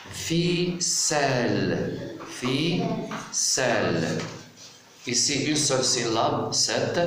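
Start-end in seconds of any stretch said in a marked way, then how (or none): 0.1-4.2 "ficelle" very slow, both syllables stretched; split into syllables. Shown as a demonstration. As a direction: draw out "ficelle" as fiii-sel. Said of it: "je découpe le mot". (« ficelle » très lentement, en syllabes étirées)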